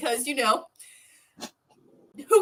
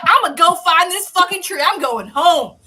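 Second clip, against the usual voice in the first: louder mock-defiant declaration; defiant Del; 6 semitones higher, 8 dB louder than usual. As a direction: storm off declaring it. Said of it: "he's like".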